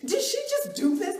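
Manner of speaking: high, loud, laughing "did she just"; shocked laughing disbelief. Real, not acted. Stunned after the coach brushed her off, a high laughing squeak. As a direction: gasp out the outrage with a laugh.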